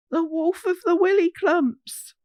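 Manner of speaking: high alarmed cry; young hogs spotting the giant paw print; frightened cry. Acted. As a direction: gasp the name in sudden fear. Children gulp in fright.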